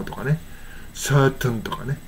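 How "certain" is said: "certain" with a released t and a vowel before n; clear t and n. Shown as a demonstration. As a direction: slowly say "certain" as sur-tun. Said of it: "toとぬははっきり発音してるとき".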